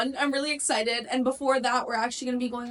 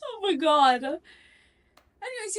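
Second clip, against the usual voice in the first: laughing hard; 6 semitones higher, much slower than usual. Real, not acted. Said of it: "laughing hard".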